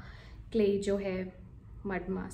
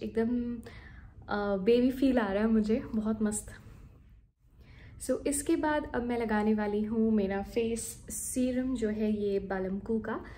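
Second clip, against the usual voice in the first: ordinary delivery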